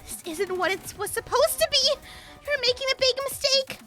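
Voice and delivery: high, loud frantic outburst; loud, high frantic outburst as she loses the vote; losing her mind. Acted. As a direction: lose it as the vote turns. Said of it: "Lux lost her mind".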